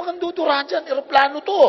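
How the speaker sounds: high loud warning; loud scolding to the new helper; alarmed scolding. Retold or imitated. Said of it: "sabi ko".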